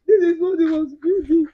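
loud, high-pitched tearful lament; crying. Real, not acted. Sister shows her mad brother's unfinished house, crying. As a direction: wail it through tears. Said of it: "I've been crying".

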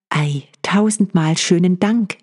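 lightly acted happy wife; delighted thanks. A narrator's reading. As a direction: thank the husband with eager pleasure. Voices the grateful wife.

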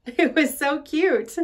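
slow, fond laughing words. Acted. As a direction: laugh through it, delighted. Laughs recalling Jimmy.

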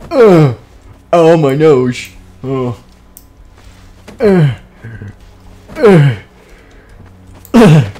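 loud groans of pain. Acted. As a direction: groan as if your nose hit a wall. Stranger hits nose.

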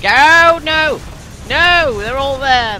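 high loud despair cries; frantic shouting. Real, not acted. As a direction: yell as the point falls. Losing the point.

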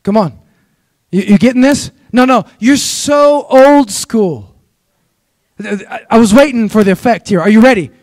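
lively preacher cadence; preaching. Acted. Pastor preaching to his church, rousing. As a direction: preach it with rising energy to the congregation.

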